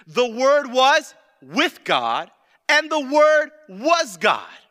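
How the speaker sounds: loud, high, drawn-out scripture proclamation; full-force preaching. Real, not acted. Preacher proclaims John chapter one to congregation, forceful preaching. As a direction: hammer out the verse slowly and loudly.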